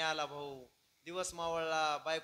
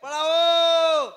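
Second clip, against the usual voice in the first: high, very loud drawn-out cry; shouting for help; 11 semitones higher, 19 dB louder, slower than usual. Acted. Wife calling people to save her husband, shouting. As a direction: stretch the last vowels into a long yell. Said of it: "तिथून जाऊन ओरडू लागली".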